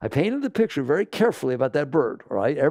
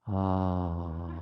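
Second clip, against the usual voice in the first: low, soft satisfied sigh; contented sigh; 7 semitones lower, 6 dB quieter than usual. Pretend. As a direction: let out a sigh of deep relief. Billionaire's mind seeing Forbes cover.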